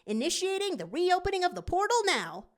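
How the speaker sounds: put-on excited young fox; Tails. A narrator's reading. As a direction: pop the word out high. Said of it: "Tails replies".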